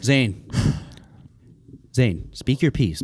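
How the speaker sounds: low, quiet, grave address; mock-solemn warning. Host granting dissenter the floor, mock-solemn warning.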